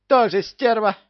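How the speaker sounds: high, loud parrot outburst; squawked insult. Acted. Parrot freed to answer Кевин, high angry squawk. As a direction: spit it out like an angry bird.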